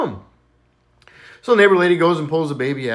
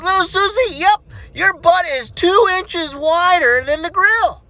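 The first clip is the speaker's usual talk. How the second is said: put-on teasing kid; 13 semitones higher, 4 dB louder, slower than usual. Johnny reports measurement.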